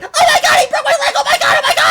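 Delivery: very high, loud mock shriek; screaming child. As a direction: shriek like a child in agony, hammed up. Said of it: "The child's screaming like".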